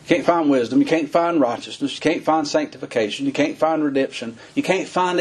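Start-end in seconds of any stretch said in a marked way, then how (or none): none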